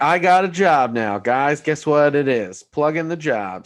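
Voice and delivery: loud, drawn-out mock announcement; Carlton. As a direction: raise your voice and stretch it out, imitating him. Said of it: "Carlton's like".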